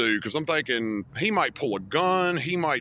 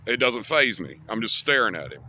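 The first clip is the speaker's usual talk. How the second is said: usual speaking voice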